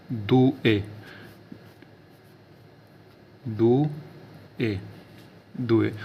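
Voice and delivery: दुए said as doo-eh, drawn out; teacher repeating दुए; Italian due. Shown as a demonstration. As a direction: stretch दुए slowly, doo-eh. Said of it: "दो को बोला जाता है दुए".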